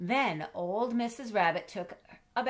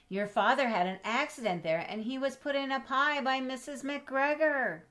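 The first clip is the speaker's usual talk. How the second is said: mother rabbit warning; 6 semitones higher, 5 dB louder than usual. Acted. Mother warning about the garden.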